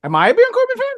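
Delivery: high incredulous echo of the question. Real, not acted. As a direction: pitch jumps way up in disbelief.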